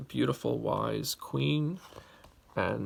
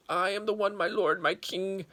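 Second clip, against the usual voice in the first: small bee; 9 semitones higher, 5 dB louder than usual. Acted.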